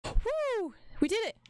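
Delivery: high victorious whoop; excited cheer. Real, not acted. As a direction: cheer the win. Cheering after success.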